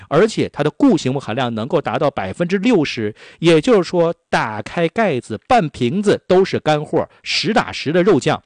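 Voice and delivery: polished, emphatic sales pitch with lively pitch swings; punchy radio commercial delivery; radio ad read. Acted. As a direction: sell it like a radio shopping spot. Recorded-style sales pitch for beef sauce.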